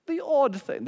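high-pitched mock British accent; imitated British guy, pitched well up. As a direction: do your best amateur British gentleman. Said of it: "this is my best British accent".

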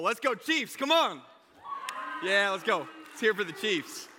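high, loud sports-fan shout; rousing team cheer. Real, not acted. Speaker leads the room cheering the Chiefs, excited.

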